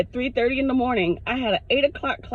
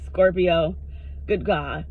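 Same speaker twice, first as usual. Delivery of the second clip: ordinary talk, nothing marked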